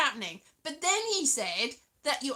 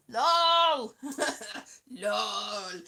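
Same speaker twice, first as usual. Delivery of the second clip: laughing lol; 3 semitones lower than usual. Real, not acted. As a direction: burst out laughing on it. Laughing at own typo.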